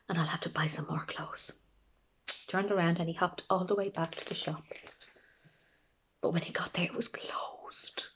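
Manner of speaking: whispered, sinking narration; storyteller hushing for the sad turn; hushed. A narrator's reading. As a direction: whisper the bad news.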